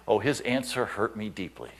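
ordinary talk, nothing marked

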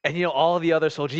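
ordinary delivery

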